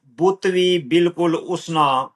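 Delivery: slow, measured verse with drawn-out words; poetry recital. Acted. Poet reciting own lines to an appreciative listener, measured recital.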